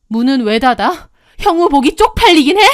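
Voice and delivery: loud, rising to a high, fast, furious jab; angry confrontation. Acted. Confronting lover caught with her husband. (화나서 쏘아붙이는 고함)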